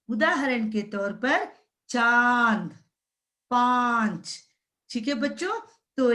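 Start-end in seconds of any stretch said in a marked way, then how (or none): 1.9-2.7 चांद with a long nasalised vowel; slow, drawn-out चांद; chandrabindu pronunciation. Shown as a demonstration. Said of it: "उस शब्द का उच्चारण बदल जाता है". 3.5-4.4 पांच with a long nasalised vowel; slow, drawn-out पांच; chandrabindu pronunciation. Shown as a demonstration. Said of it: "उस शब्द का उच्चारण बदल जाता है".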